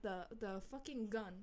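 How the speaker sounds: ordinary delivery, nothing marked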